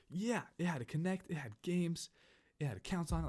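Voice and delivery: put-on high-pitched kid rattling off perks; eager, boastful retold list; excited bragging. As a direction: imitate a friend showing off. Friend brags about the console's contents.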